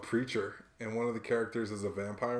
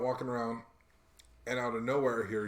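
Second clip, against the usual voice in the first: ordinary talk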